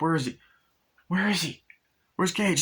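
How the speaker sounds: father suddenly missing his son; worried dad. Retold or imitated. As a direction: ask in growing panic. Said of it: "and he's like".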